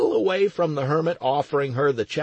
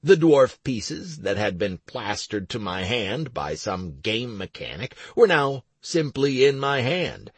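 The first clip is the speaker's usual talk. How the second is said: ordinary delivery, nothing marked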